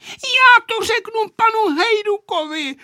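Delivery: put-on very high, loud child's threat; girl's outcry. A narrator's reading. Aneška threatening to tell on grandpa, crying out. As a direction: cry out shrilly as an indignant girl. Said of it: "vykřikla Aneška".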